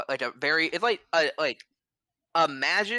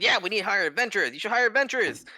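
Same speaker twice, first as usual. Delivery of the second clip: high, loud and fast crowd chant; eager townsfolk clamouring, pitched up; riled-up crowd; 9 semitones higher, 4 dB louder, faster than usual. Acted. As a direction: whip the crowd into agreement, fast. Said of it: "get riled up and be like".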